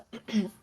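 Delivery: quick throat clear. Real, not acted. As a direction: clear your throat briefly. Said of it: "I got something in my throat".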